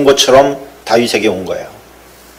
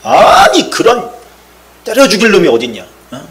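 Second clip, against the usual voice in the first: much higher and louder retold rage; furious outburst; 7 semitones higher, 3 dB louder than usual. King David erupting at the rich man's cruelty, furious shout. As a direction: hurl it out like a king enraged. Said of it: "확 화를 낸 거예요".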